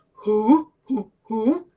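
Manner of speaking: loud repeated hooting call; Auntie Georgie. Retold or imitated. Auntie signalling where she is, imitated loud hoot. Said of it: "It's my Auntie Georgie".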